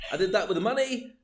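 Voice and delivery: ordinary talk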